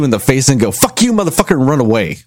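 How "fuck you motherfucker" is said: jokey high-pitched curse after a punch; mock insult. Someone punching you, then yelling a mock curse. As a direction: spit out a cartoonish insult.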